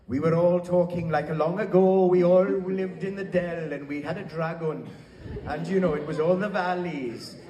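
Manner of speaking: lightly hammed-up Welsh accent, laughing at the end; mock Welsh accent. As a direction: play up a sing-song Welsh accent. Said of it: "we basically were Welsh".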